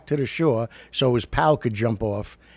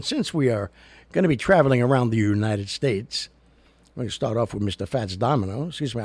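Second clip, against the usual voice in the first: ordinary talk, nothing marked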